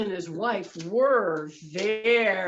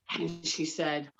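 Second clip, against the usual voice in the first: ordinary delivery, nothing marked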